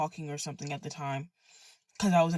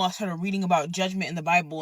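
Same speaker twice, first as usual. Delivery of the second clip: ordinary delivery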